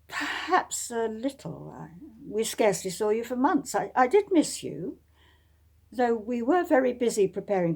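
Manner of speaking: a brave face over hurt; lightly voiced young lady, faltering; wistful Marianne. Marianne answers, hiding hurt.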